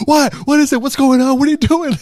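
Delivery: high, loud confused alarm; Seth Rogen alarmed. Retold or imitated. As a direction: scramble to figure out what's wrong. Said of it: "Seth Rogen's like".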